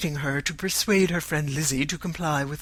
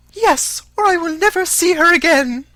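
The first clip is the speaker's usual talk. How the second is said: high, very loud outburst; vehement Mrs. Bennet; 12 dB louder than usual. A narrator's reading. Mother threatening to disown her daughter, vehement. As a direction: threaten in a high, indignant cry.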